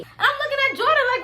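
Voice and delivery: normal talking voice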